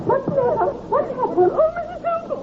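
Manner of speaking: alarmed after a fright; frightened. Acted. Frightened girl after night scare.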